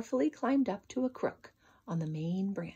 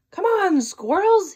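much higher, much louder shout; 10 semitones higher, 12 dB louder, slower than usual. Acted. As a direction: call up into the tree at full voice. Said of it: "he shouted".

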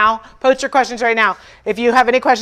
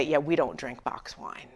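snooty dismissal; 8 semitones lower, 15 dB quieter than usual. Retold or imitated. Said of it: "kind of the way you would say".

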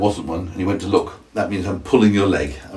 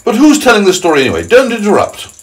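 high, loud, quick telling-off; indignant scolding; 13 semitones higher, 7 dB louder than usual. Acted. Centipede rebuking the interrupters, indignant and high. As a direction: cut them off sharply, high and loud.

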